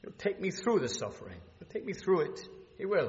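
usual speaking voice

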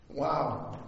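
ordinary talk, nothing marked

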